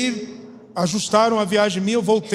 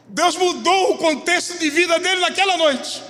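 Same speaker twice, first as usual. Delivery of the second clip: high-pitched, forceful preacher delivery; fervent pulpit testimony, pitched up; impassioned preaching; 8 semitones higher than usual. Real, not acted.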